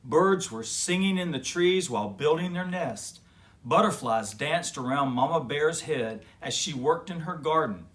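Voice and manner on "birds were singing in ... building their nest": plain reading voice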